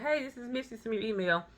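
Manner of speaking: ordinary talk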